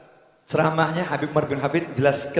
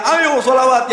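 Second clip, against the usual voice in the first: higher, louder rallying cry; calling out; 9 semitones higher, 4 dB louder, slower than usual. Retold or imitated. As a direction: call the crowd to sing along. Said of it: "Habib Syekhnya teriak-teriak".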